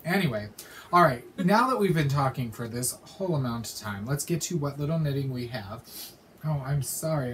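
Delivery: ordinary talk, nothing marked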